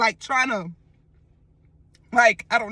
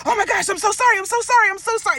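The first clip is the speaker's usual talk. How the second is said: frantic apology; 8 semitones higher, 4 dB louder, much faster than usual. Retold or imitated. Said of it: "I'm automatically in defense mode".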